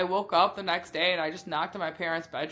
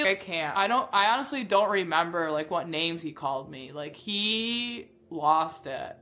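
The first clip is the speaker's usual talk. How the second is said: ordinary talk, nothing marked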